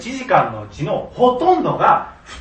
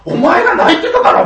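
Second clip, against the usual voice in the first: mock retort; 7 semitones higher, 6 dB louder than usual. Angry retort in his head.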